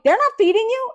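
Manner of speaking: can't believe it; shocked to hear the roommate hasn't been fed; outraged disbelief. Retold or imitated. Learning roommate isn't fed.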